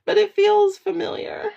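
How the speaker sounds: high, choked-up voice near tears; high tearful murmur of recognition; tearful awe. Retold or imitated. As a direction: voice the pull of something familiar, close to crying. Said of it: "You know, it's like".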